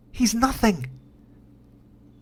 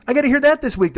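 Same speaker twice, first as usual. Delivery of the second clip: usual speaking voice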